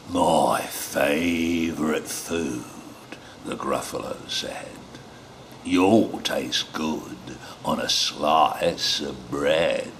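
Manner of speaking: low, slow monster growl; Gruffalo voice. Acted. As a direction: rumble it like a big beast. Gruffalo speaks in story.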